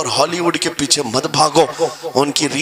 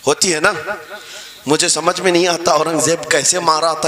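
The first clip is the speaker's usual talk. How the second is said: normal talking voice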